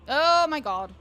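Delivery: high, loud amazed cry; loud exclamation. Real, not acted. Gamer cries out in disbelief at the rhyme. Reacting to poem.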